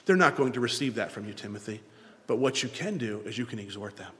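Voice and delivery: put-on Paul advising young Timothy, soft and low; quiet fatherly counsel. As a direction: counsel him gently and quietly. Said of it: "he says".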